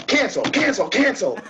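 high, loud, frantic mock outrage; mock cancel mob. Mock moderators spotting a MAGA hat, shrill outrage.

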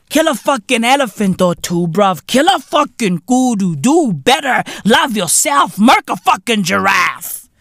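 ordinary talk

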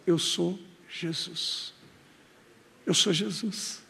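low, soft, slow divine voice; hushed voice of Jesus. Acted. Jesus answering Paulo on the road, hushed and slow. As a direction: speak as Jesus, quiet and weighty.

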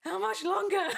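high, loud impatient wail; desperate yell. Retold or imitated. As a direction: shout it, desperate to get out. Acting out impatient second call.

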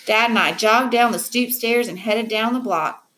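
ordinary delivery

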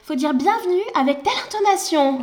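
mock manager voice. Mocking the director's scripted greetings, high shrill parody. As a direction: ham up the boss dictating greeting rules.

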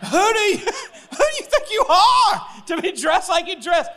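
high, hammed-up indignation with a laugh in it; mock outrage. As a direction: squawk it in comic disbelief. Hammed-up outrage at someone's outfit.

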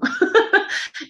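short, high burst of laughter. Real, not acted. Speaker laughs after recalling her childhood plea, high laugh. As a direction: break into a quick high laugh.

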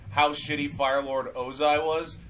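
ordinary talk, nothing marked

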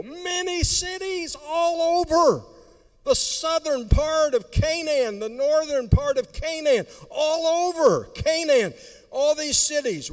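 high, ringing preaching; preaching at full force. Acted. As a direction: proclaim it loud.

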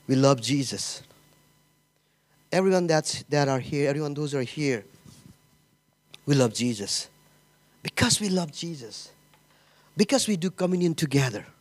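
ordinary talk, nothing marked

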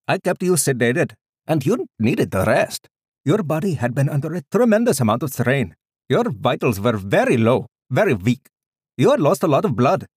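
put-on high, loud, lilting Indian-accented doctor; thick Indian accent. A narrator's reading. Doctor explaining sedation to his patient, high loud thick Indian accent. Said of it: "he said in a thick Indian accent".

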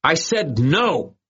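high, slow roar of refusal. A narrator's reading. Boy roaring at the bullies. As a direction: bellow it out, slowly. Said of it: "Bigfoot Irwin roared".

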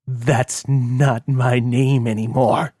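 very slow, weighty. Acted. Kilroy telling Dania his name has changed, slow and firm. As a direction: lay each word down deliberately.

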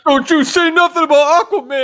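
high, loud hammed-up protest; mock outraged yell. Friend mocking the other podcaster's comics jab, high mock yell. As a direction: pretend to defend a beloved hero.

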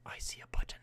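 entranced stage whisper. Acted. Button thief spotting his target, whispered. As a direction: whisper it like a secret obsession.

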